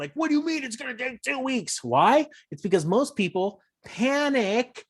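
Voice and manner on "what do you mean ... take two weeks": indignant buyer. Retold or imitated. Panicked buyer complaining.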